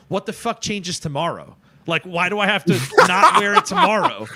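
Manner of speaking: half-laughing protest at the absurd rule; incredulous laugh. Retold or imitated. Pushing back at the mall guard, laughing in disbelief. Retold argument with security guard.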